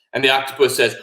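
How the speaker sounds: plain reading voice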